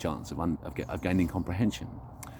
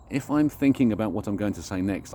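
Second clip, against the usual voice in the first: ordinary talk, nothing marked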